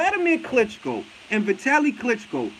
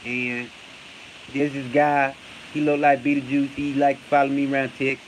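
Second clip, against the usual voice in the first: Errol Spence; 6 semitones lower than usual. Retold or imitated. Fan mocking how a boxer talks, low mumbled impression. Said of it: "That's how he talk".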